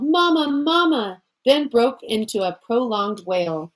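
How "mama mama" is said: high, slow frantic call; wild calling. A narrator's reading. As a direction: call out wildly, lost in the dark. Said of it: "More and more wildly she called".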